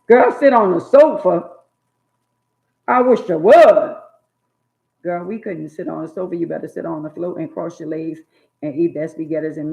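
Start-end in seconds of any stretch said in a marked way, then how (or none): 0.1-4.0 high, loud warning mama; mock stern mama. Mama daring her kid to sit on the sofa, high and loud. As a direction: warn like a mother daring you.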